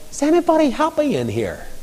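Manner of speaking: high, soft call into a room; high-pitched, lightly acted inquiry; calling in at a door. Calling into a pub doorway.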